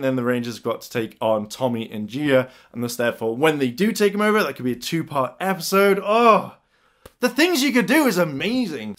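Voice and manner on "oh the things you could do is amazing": gushing high-pitched enthusiasm; real excitement. Real, not acted. Fan thrilled by his own theory, gushing. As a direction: gush over it, voice leaping up.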